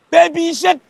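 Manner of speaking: proclaiming his love to the village, shouting; shouted love declaration. Pretend. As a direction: shout it for the whole world to hear. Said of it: "Laisse-moi crier ton nom".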